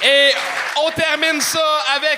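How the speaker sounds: high, slow, shouted stage announcement; emcee shout. Acted. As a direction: belt the name out to the crowd. Host shouts the last act's name.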